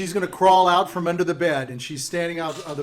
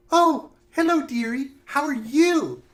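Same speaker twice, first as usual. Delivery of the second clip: put-on high, drawn-out, cooing old witch; sugary witch; 10 semitones higher, 4 dB louder, slower than usual. Witch greets a newcomer at her door, high and sugary. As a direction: greet them with exaggerated high sweetness. Game master plays the witch.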